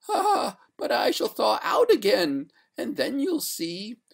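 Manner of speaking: high, wailing threat; threatening ghost. A narrator's reading. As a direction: warn him in a high ghostly cry. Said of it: "cried the lady threateningly".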